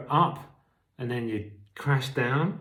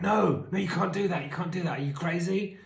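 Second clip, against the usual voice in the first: higher, pleading inner voice; addict voice; 7 semitones higher than usual. Retold or imitated. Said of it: "my addict will cling on to it and be like".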